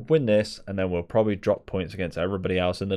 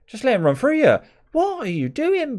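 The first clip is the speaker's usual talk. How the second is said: much higher, louder frustrated yell at the team; exasperated yell; 7 dB louder than usual. Real, not acted. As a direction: shout in disbelief at your players. Shouting team talk at players.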